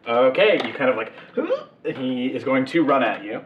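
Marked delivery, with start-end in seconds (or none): 1.2-1.9 sharp gasp. Acted. Enemy charges at player.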